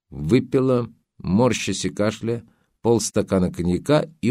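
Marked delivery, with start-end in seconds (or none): none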